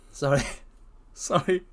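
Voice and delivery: usual speaking voice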